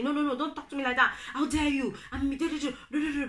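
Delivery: high, fast indignant shouting at sister; retold shout. Shouting back at her sister in a family argument. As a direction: snap back fast and high, how dare you. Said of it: "Me, I was be shouting".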